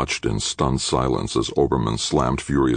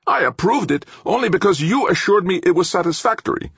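high, loud defensive protest; alarmed professor; 5 dB louder than usual. A narrator's reading. Professor disowning his approval of the design, alarmed. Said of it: "Suddenly Ulrich looked alarmed".

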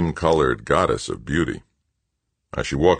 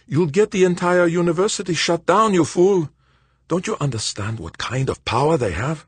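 Verban, alarmed and scolding; 14 semitones higher than usual. A narrator's reading. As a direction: call him a fool in exasperation. Verban's voice in the read story.

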